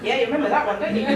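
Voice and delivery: normal talking voice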